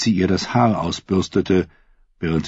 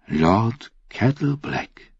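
enticing; 4 semitones higher, much slower than usual. A narrator's reading. Queen tempts him with a title.

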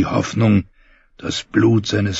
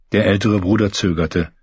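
usual speaking voice

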